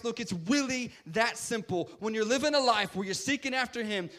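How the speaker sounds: high-energy preacher in full flow; impassioned preaching. Real, not acted. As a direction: drive the message home to the congregation. Preaches at raised force.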